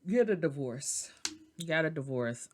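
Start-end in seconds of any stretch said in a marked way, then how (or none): 0.1-1.1 words caught in a real yawn; yawn-stretched words. Real, not acted. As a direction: say it as a yawn comes on. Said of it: "I don't know why I'm yawning".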